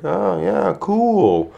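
high, flat, uninterested; unimpressed. Retold or imitated. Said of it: "she was like".

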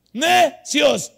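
"Necios" shouted high and loud, syllable by syllable; emphatic NECIOS. Real, not acted. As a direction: split "Necios" into two loud beats, NE... CIOS. Hammers the key word, repeated.

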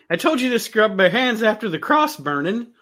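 high-pitched staged reprimand; mock scolding. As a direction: scold as if putting on a show. Repeating the film's scolding line.

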